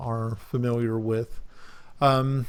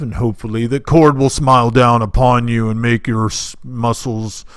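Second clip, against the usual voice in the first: booming priest; 9 dB louder than usual. Acted. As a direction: boom it out like a mountain of a man. GM voices the big priest.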